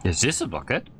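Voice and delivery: usual speaking voice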